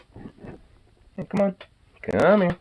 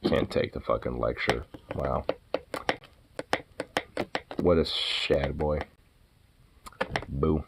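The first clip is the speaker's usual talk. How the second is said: much lower and softer, sulky mumbling; low mock-sulky mutter; 10 dB quieter than usual. Real, not acted. Owner mutters at the dog who walked off, low and sulky. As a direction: mutter it like a sulking insult.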